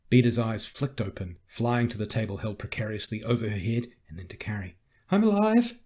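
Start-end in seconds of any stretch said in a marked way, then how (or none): 5.1-5.8 high, loud burst of realisation; high-pitched, startled cry; startled survivor. Acted. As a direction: call out in amazement at surviving. Pinned survivor calls out alive.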